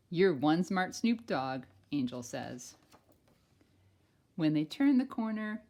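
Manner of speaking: loud, bright storybook read-aloud; reading to children. Acted. Teacher reading a picture book to kindergartners, loud and lively. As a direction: read it big for a class of kids.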